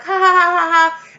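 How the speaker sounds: loud, high fake laugh; mock childlike laughter. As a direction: imitate careless kids laughing. Imitates kids playing carelessly.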